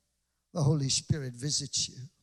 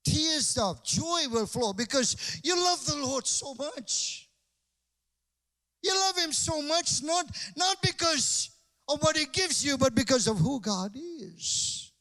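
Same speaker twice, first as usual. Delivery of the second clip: fiery sermon voice; full-force preaching; 9 semitones higher than usual. Acted. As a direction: preach it out to the crowd.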